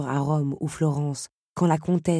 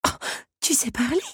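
high-pitched, startled question; taken aback, voice leaping up; astonished; 9 semitones higher than usual. A narrator's reading. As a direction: gasp it out, nearly choking with surprise. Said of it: "faillit s'étouffer celle-ci".

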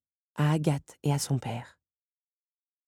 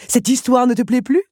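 higher, louder villain sneer; cruel taunt; 8 semitones higher, 7 dB louder than usual. Acted. Henri taunts Belle, sneering. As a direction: twist the knife.

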